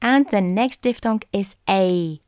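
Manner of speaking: ordinary talk, nothing marked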